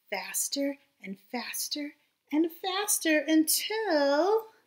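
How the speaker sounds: lively storybook read-aloud voice; reading to children. A narrator's reading. Teacher reading a storybook aloud to her class, lively. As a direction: read it bright and animated for little listeners. Reads picture book to children.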